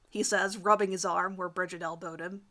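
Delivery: usual speaking voice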